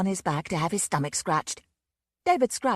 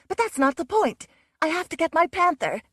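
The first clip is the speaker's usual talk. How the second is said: urgent insistence; 11 semitones higher, 6 dB louder than usual. A narrator's reading. Susan insistent.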